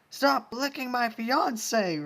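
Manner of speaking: high, loud, slow furious order; jealous screaming demand. Acted. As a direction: demand she stop at once. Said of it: "Rainbow Dash demanded".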